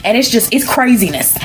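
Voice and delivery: high-pitched, frantic storytelling; excited narration. A narrator's reading. Storyteller describing pandemonium in the room, excited.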